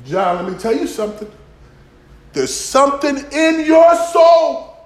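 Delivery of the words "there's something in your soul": slow, high shouted line; preacher's shout. Acted. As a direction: cry it out slowly from the pulpit.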